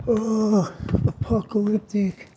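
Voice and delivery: high-pitched mock-dread voice; mock doomsaying. Vlogger dismissing doom and gloom to viewers, mock doomsaying.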